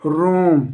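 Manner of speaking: रोम with the O held very long; रोम as one sustained, drawn-out syllable; prolonged pluta vowel. Shown as a demonstration. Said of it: "आपके जो प्लुत स्वर हैं".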